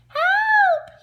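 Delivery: very high, loud scream; screamed for help. A narrator's reading. Wolf faking danger again, screaming. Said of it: "he screamed".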